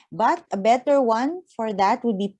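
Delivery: normal talking voice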